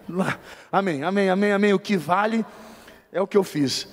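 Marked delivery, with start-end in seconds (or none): none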